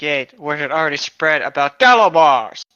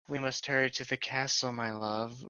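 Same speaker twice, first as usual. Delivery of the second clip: much softer, hushed plea; hushed urgency; 13 dB quieter than usual. Acted. Wife urging her husband to the castle, much softer. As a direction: hush it to your love urgently.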